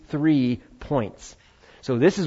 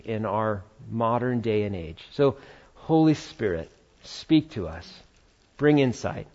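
softer, low, reverent prayer voice; 4 semitones lower, 4 dB quieter than usual. Real, not acted. Pastor leading the congregation before God, in prayer. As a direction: pray quietly, pausing to search for words.

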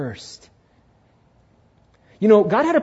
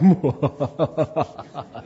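villain laugh; 4 semitones lower than usual. Pretend. Vengeful person gloats.

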